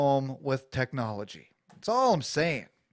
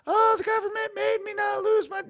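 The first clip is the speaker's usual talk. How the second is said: whiny mock quote.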